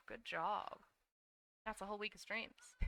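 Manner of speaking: ordinary talk, nothing marked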